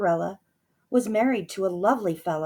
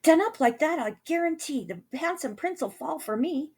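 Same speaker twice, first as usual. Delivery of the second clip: lightly voiced fairy; 9 semitones higher than usual. A narrator's reading. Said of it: "the fairy said".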